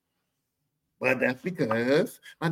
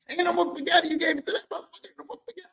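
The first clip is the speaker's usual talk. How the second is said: laughing mom; 12 semitones higher, faster than usual. Retold or imitated.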